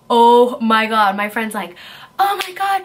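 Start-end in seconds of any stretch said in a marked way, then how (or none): 0.1-1.2 loud, drawn-out "oh my God"; acting out your own panic, heavy and slow; freaking out. Storyteller recalling seeing the man approach her car, acted freak-out. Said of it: "I'm freaking out, I'm like". 2.2-2.8 high-pitched imitation of a panicking friend; friend freaking out. Friend in the car spotting a gun, imitated high panic. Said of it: "my friend's freaking out".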